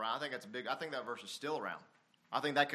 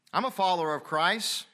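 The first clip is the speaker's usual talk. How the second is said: high, loud imitation of a professing believer; mock proclamation; 8 semitones higher, 9 dB louder than usual. Preacher voicing a hypocrite to the church, mock proclamation. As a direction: announce it loudly as a hypocrite would.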